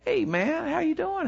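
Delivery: put-on high, bright hello to an old friend; cheery greeting. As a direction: greet him warmly, pitched well up. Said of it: "And I was like".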